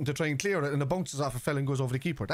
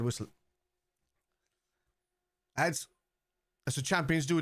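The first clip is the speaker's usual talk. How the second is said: ordinary delivery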